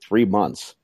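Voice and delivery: usual speaking voice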